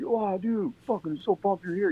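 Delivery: high, excited imitation of a thrilled friend; pumped. Edwin thrilled the narrator came, high excited voice. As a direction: lift it high, all stoked.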